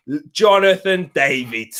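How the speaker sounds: normal talking voice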